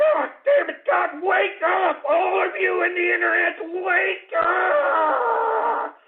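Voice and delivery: high, strained shout at listeners; desperate yelling. Real, not acted. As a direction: yell to rouse them, stretching the last word.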